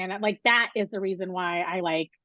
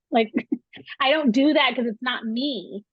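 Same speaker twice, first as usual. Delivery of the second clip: normal talking voice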